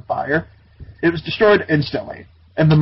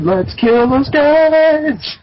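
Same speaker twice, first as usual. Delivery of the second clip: very slow, much higher hammed-up villain line; mock villain; 11 semitones higher, 4 dB louder, much slower than usual. Monsters deciding to attack, hammed-up slow villain. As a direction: drag it out like a cartoon baddie. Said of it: "and said".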